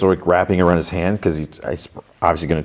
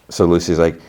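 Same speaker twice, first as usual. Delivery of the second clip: ordinary talk, nothing marked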